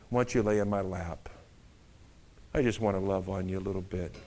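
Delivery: soft, cooing invitation; lightly seductive coax. Retold or imitated. Delilah luring Samson to rest in her lap, soft coaxing. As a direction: coax him softly into your lap.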